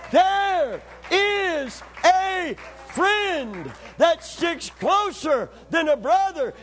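high, loud, stretched-out preacher's cry; full-force preaching. Acted. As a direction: preach it with rising fire, drawing out the words.

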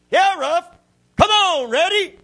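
loud, very high-pitched calling of dogs; mynah mimicking its owner. Mynah bird tricks the dogs from the porch, mimicking its owner's loud high call. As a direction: imitate a bird imitating a woman calling her dogs. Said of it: "He said it in my voice".

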